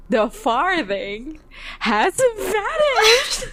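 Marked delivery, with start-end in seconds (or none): 1.8-3.5 grand magic reveal. Pretend. Reading the show's trick to her cohost, a showman's slow reveal. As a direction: stretch it out like a stage magician.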